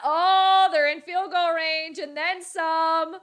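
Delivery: loud, high, drawn-out game excitement; excited shout. Real, not acted. Streamer shouting as the Bills drive downfield. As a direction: cheer it out as the drive goes on.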